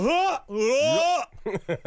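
warm-up grunts. Shown as a demonstration. Comedian shows his accent warm-up, two mock huffs. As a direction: huff twice to get into the accent. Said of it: "What I do for Jamaican is I go".

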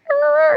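high yappy dog sound; happy dog noise. Retold or imitated. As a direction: imitate an elated little dog. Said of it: "he's like".

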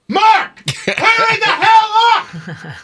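high, slow shouted demand; yelling producer. Retold or imitated. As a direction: holler at the anchor to hurry. Producer yelling at desk anchor.

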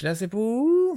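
high mock question from the woman on the phone; a light imitation of the confused caller; imitating her question. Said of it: "Non, j'ai pas pris d'accent".

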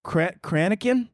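"Cranachan" as CRAN-uh-kin, after a false start; stumble, then stress the first syllable of "Cranachan"; unsure pronunciation. Shown as a demonstration. Reader guessing at a dessert name, "Cranachan" as CRAN-uh-kin. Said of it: "I don't even know how to say".

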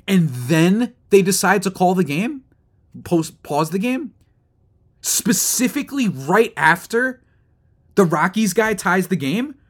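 exasperated rant. Real, not acted. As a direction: fume at the umpires, voice climbing high. Ranting about the paused game.

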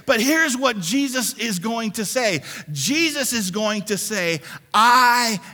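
high, loud sermon delivery driving each line home; rousing preacher voice; full-force preaching. Acted. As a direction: preach it hard to the whole congregation.

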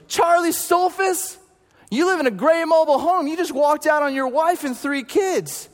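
high, loud, commanding prophetic call; prophetic confrontation. Retold or imitated. Tony confronts hitchhiker with a loud, high prophetic word. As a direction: declare it with prophetic authority.

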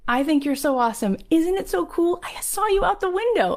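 high-pitched starstruck gushing; excited. Retold or imitated. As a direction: imitate an overjoyed fan meeting a hero. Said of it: "I'm so excited that you're here".

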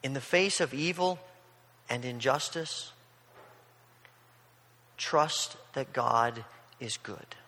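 slow, soft, weighty reading of the summary line; slow solemn summary. Shown as a demonstration. Preacher to congregation, sums up the psalm slowly and softly. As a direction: let each phrase settle, slowly. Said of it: "it would say something like this".

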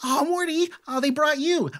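high, gushing delight at seeing the dog; very excited. Acted. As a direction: squeal a greeting to a beloved dog. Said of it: "she's actually very excited to see Morty".

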